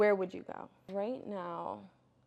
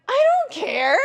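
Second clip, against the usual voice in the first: panicked shout; 14 semitones higher, 18 dB louder, slower than usual. Retold or imitated. Retelling panic during C-section.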